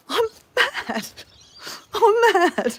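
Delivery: high, drawn-out silly protest; loud mock-angry outburst breaking into laughter; mad but laughing. Real, not acted. Ranting over vanished eggs, laughing at own anger. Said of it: "And I'm laughing at myself because it's silly".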